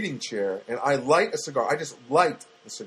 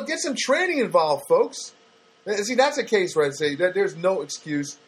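normal talking voice

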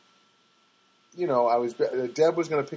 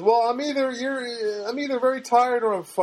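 giggly clerk voice; 10 semitones higher than usual. Retold or imitated. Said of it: "And she goes".

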